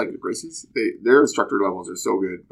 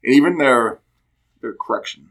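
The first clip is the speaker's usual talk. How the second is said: ordinary talk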